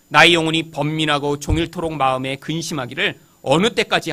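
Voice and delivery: low, even, firmly projected recitation; scripture reading. Acted. Preacher reading a psalm of lament to the congregation, solemn recitation.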